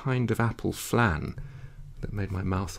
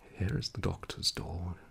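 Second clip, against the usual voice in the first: hushed and much softer; whispered; 8 dB quieter than usual. A narrator's reading. Chambermaid at the doctor's dark door, hushed. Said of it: "she whispered".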